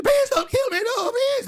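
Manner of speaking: very high, wailing mock victim; terrified pleading. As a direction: beg shrieking for your life, hammed-up. Acts the effigy victim begging.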